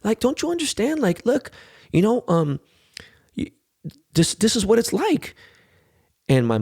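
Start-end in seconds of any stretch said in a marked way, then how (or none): 0.0-5.4 pleading with son. Retold or imitated. Dad pleading with crying son.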